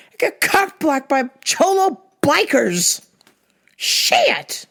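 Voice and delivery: emphatic drawn-out mock cursing; hammed-up disgust; retold frustrated cursing. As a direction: curse your luck, pitch leaping up on the last word. Retold cursing on going home.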